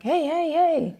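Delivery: acted-out hailing; calling out. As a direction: call for a cab in a high voice.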